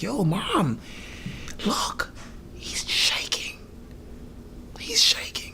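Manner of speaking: whispered aside to a parent; imitating a brother's courtroom whisper; hushed alarm. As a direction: whisper it slowly, drawing attention discreetly.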